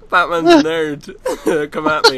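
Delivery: high, loud playful taunt; mock taunt. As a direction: shout it out to provoke friends. Mock provocation to friends.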